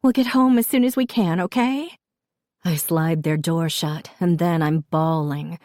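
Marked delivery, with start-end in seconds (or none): 0.0-2.0 high, rushed, tearful reassurance; holding back tears. A narrator's reading. Mother reassures sick daughters, struggling not to cry. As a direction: hurry out comfort while fighting tears. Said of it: "struggling not to cry myself".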